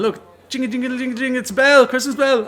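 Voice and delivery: high-pitched jingle imitation; jingling bell act. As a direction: jingle away hopefully like a fake bell. Man fakes bell with keys.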